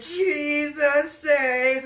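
slow high drawn-out shout; teen answers the preacher with a stretched yell; yelling back. Retold or imitated. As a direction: join in and yell it back down the street. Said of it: "I turned around and went".

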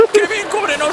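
high panicked plea; being chased. Pretend. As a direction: plead for cover in panic. Fleeing, calling to Kevin.

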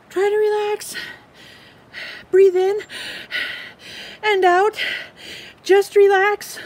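high, fast, frantic little squirrel voice; excitable squirrel. Acted. Squirrel frets over his missing leaf, high and anxious. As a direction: play the squirrel, jumpy and loud.

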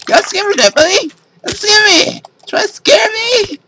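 high squealing panic, half laughing; scream into giggles. Real, not acted. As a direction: shriek it way up high, then giggle. Squealing at scary monster.

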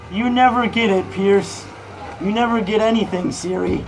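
loud, high frustrated yelling; angry yelling. Real, not acted. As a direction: shout it at the phone in frustration. Yelling at Siri and Pierce.

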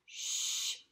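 "Shh" hushed, breath only; a long quiet shush; shh sound. Shown as a demonstration. Teacher modelling the sh sound for kids, hushed. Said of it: "S H says".

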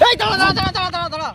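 high, rapid-fire plea to stop; acted high-pitched panic; panicked protest. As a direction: hold off an attacker in alarm. Guru under attack begs him to stop.

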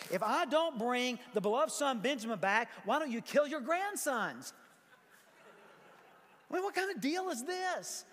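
high-pitched, climbing disbelief; mock incredulous. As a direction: ridicule the offer, pitch rising to a squeak. Mocks Reuben's absurd offer in disbelief.

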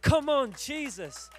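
loud, high cry of praise; triumphant shout. Retold or imitated. Preacher capping his testimony to the crowd, triumphant shout. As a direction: burst out in celebration, then drop back.

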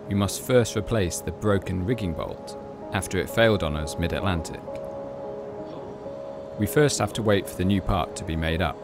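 ordinary delivery

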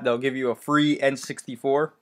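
normal talking voice

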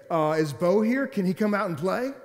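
child playing pretend visitor; kid asking for Bo. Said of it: "then I would say".

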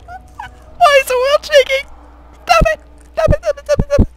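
very high, squeaky distress; panicked whimper. Real, not acted. Player as the world shakes, panicked high squeal. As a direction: squeal in fear as everything shakes.

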